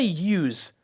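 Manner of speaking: "use" said as youse, plural of you; Jersey "youse". Shown as a demonstration. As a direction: pronounce "use" like yooz. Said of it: "you say".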